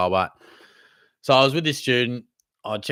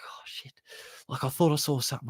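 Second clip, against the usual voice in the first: hushed scared whisper, imitated; whispered fright; 2 semitones higher, 6 dB quieter than usual. Spooked student looking back on walk, whispered scare. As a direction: breathe it like a scared kid.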